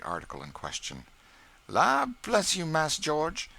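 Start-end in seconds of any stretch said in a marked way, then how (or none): 1.7-3.5 higher, louder earnest plea; earnest Aunt Chloe. A narrator's reading. Cook stopping the boy cutting her cake, earnest. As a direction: stop him with real concern, pitched up. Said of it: "said Aunt Chloe with earnestness".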